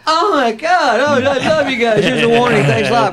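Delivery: high-pitched gushing fan voice; mock starstruck gushing. Officer lets rock star off with warning, mock starstruck gushing.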